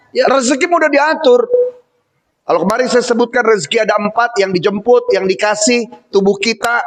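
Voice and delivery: usual speaking voice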